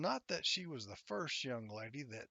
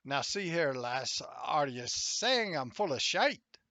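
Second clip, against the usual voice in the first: a hammed-up Irish uncle teasing his niece; Irish accent; 3 semitones higher, 9 dB louder than usual. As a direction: put on your best Irish brogue, louder. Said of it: "In my best Irish accent".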